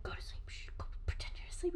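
fast, hushed whisper; panicked whisper. Retold or imitated. Boys hiding from approaching mom, panicked whisper. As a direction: whisper hurriedly, shushing.

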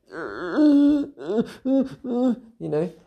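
voice breaking. Real, not acted. Retelling crying, voice cracks.

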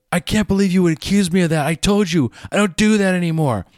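high, fast defensive outburst; blowing up. Retold or imitated. Teen blowing up at his accusing mother, fast and high. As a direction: snap back at mom, offended and fast. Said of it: "blow up at your mother".

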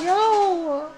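high, drawn-out exclamation; exasperated disbelief. Real, not acted. Storyteller interrupted by a drag racer, exasperated disbelief. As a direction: react to a rude interruption.

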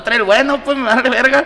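indignada. Real, not acted. Reclama por el logo, indignada.

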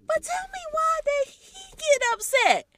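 high, loud disbelieving demand; outraged question. Real, not acted. Outraged at the boyfriend's reaction.

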